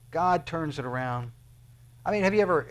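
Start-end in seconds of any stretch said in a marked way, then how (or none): none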